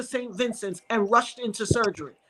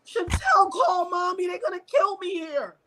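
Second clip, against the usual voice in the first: high, tearful cry for help; sobbing, raised-pitch panic while reliving it; crying plea; 7 semitones higher than usual. Retold or imitated. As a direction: wail it desperately, voice breaking. Reliving desperate tearful call to sister.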